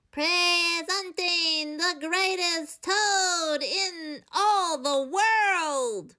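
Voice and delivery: high, slow, drawn-out announcer call; strange far-off announcer. A narrator's reading. A strange voice announces Toad to the theater, slow and grand. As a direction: present Toad like a grand showman. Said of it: "A strange voice from far away said".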